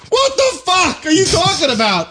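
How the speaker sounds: high, incredulous outburst; outraged. Real, not acted. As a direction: shout it in disbelief. Outraged at the news story.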